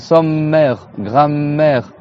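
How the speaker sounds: sommaire, grammaire with a long held M; doubled M. Shown as a demonstration. Teacher showing old French sommaire, grammaire, M doubled. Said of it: "on faisait les deux lettres".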